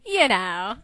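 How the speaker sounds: knowing tease. Acted. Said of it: "He turned and grinned at Jason".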